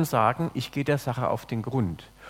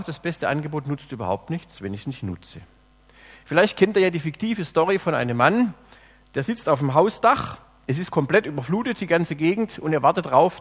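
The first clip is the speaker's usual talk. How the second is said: ordinary talk, nothing marked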